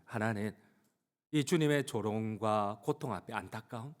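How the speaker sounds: normal talking voice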